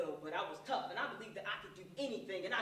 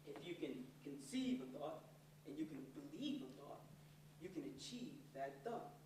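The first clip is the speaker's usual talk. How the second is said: dad's advice; 5 semitones lower, 7 dB quieter than usual. Retold or imitated. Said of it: "So Dad would say".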